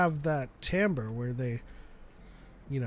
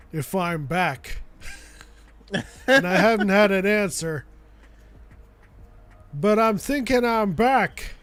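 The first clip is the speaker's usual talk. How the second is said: Keanu Reeves impression; 4 semitones higher, 6 dB louder than usual. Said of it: "that was my Keanu Reeves".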